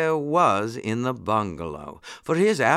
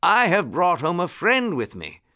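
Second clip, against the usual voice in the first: higher-pitched announcing call; 9 semitones higher, 4 dB louder than usual. A narrator's reading. Rabbit gentleman announcing a guest at home, calling out. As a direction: call the news into the house. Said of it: "called Mister Longears".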